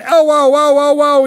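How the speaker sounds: high, loud mock cries of "ow"; mock pain cries. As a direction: yelp like a sinner in hell, hammed up. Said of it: "and they're going".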